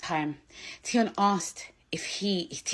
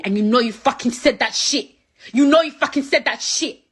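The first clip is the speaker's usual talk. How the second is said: angry accusation; 3 semitones higher, 7 dB louder than usual. Real, not acted.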